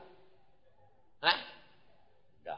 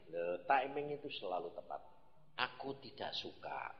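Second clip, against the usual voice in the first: low soft voice of God; 12 semitones lower, 10 dB quieter than usual. Retold or imitated. Preacher voicing God to his congregation, low and hushed. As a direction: lower and softer, a quiet divine rebuke. God's voice retold.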